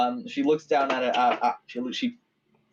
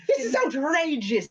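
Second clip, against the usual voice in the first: high, outraged elf cry. Acted. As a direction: squeal it as an offended elf. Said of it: "big high elf voice".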